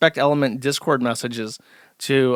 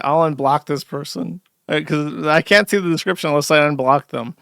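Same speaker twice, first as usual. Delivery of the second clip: ordinary talk, nothing marked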